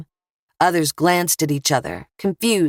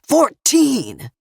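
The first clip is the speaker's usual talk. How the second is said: high, louder repeat of "Fourteen!"; "Fourteen!" thrown out higher and louder; louder yell; 8 semitones higher, 3 dB louder than usual. A narrator's reading. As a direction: push the word up, desperate.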